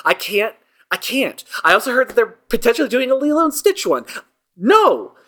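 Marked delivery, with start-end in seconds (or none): 4.6-5.1 outraged no. Real, not acted. Outraged protest at remake news.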